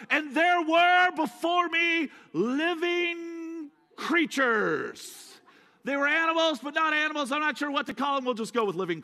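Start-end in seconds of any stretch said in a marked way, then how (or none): 0.1-5.3 slow, high, hushed-awe quote; awestruck John. Retold or imitated. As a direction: stretch it out like a stunned witness. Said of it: "He goes".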